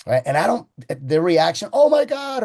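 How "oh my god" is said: mock shock. Customer reacts to high price.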